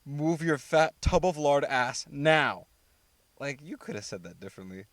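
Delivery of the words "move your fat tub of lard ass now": slow, loud contemptuous barking at the cameraman; rude order. Retold or imitated. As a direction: spit it out slowly and loudly, full of contempt. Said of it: "she literally says the line".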